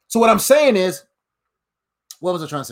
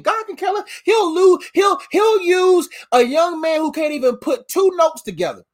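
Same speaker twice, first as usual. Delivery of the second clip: preaching shout; 7 semitones higher than usual. Real, not acted. Preaching peak.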